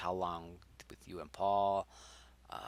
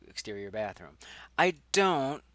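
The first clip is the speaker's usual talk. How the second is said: usual speaking voice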